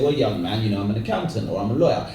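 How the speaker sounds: low-pitched, avuncular reply; older professional man. Acted. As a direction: play a successful man advising a youngster. Voicing a well-off professional man.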